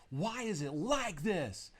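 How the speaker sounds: slow, high-pitched wail of protest; retold frustrated yell. Frustrated student to the language teacher, yelling. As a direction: drag it out, high and exasperated. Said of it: "So I'm just yelling".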